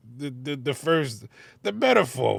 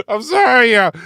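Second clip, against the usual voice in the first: laughing apology; 11 semitones higher, 12 dB louder than usual. Real, not acted.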